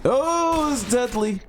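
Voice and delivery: high-pitched alarmed outburst; startled. Real, not acted. Player hit by sudden danger in the game, startled. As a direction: break off with a high yelp.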